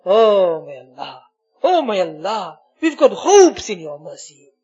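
ordinary talk, nothing marked

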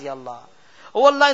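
usual speaking voice

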